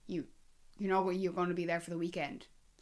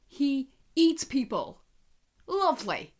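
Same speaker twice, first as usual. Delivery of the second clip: sarcastic; 7 semitones higher, 8 dB louder, slower than usual. Real, not acted. Mock-cheerful horror at dog.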